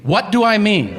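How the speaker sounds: high, exasperated repeat of the question; incredulous echo. Retold or imitated. Diner to oblivious waiter, incredulous high echo.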